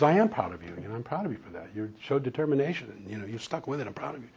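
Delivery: tender praise. Retold or imitated. Husband affirming his wife in counseling, soft and tender. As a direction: gently tell her you're proud.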